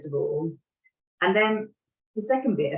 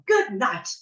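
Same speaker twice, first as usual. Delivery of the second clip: curt angry goodnight; 6 semitones higher, 4 dB louder than usual. Retold or imitated. Storming out of daughter's bedroom.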